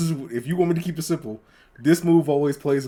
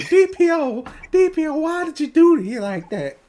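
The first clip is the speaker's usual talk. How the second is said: high loud scolding grandma; 14 semitones higher, 6 dB louder than usual. Acted. Grandma scolds grandson after the hit, high and loud. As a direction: call him out like an upset grandma.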